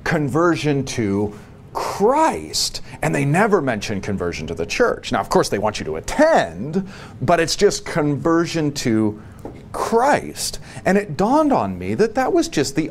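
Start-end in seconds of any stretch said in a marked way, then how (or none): 0.0-2.8 Christ drawn out long after a slow lead-in; stress on Christ. Retold or imitated. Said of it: "guess the way the evangelicals teach". 7.8-10.6 slow lead-in, then a pause and a high Christ; stress on Christ. Retold or imitated. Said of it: "guess the way the evangelicals teach".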